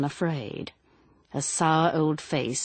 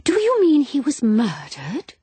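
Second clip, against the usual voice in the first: high, eager rising question; leaping on the idea, breathless with interest; 9 semitones higher, 6 dB louder than usual. A narrator's reading. Woman eagerly asks policeman if murder, eager high question. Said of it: "her eager, questioning face".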